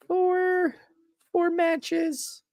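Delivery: squeaky high mock outrage; mock disbelief. Fan whining about only four matches, in a high squeak.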